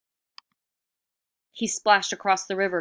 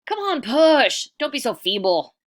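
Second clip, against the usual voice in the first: impatient urging; 9 semitones higher, 10 dB louder than usual. Acted. Iktomi urges the animals straining at the boulder, loud and bossy.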